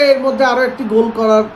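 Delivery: ordinary delivery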